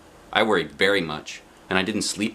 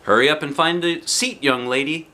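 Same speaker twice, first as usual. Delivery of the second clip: higher, brisk driver voice; a lightly voiced driver moving her along; bus driver hurrying; 6 semitones higher than usual. A narrator's reading. Mister Wu telling the girl to sit, brisk. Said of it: "said Mister Wu".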